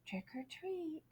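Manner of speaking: very soft, breathy trick or treat; hushed whisper. Acted. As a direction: barely breathe it. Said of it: "so he said very softly".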